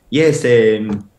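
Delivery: broken English in a put-on Mexican accent, halting with uh's; fake Mexican accent. Faking a Mexican accent so the staffer takes him for a recruit. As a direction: play a Spanish speaker, faking a Mexican accent and barely any English. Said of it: "all this with my accent".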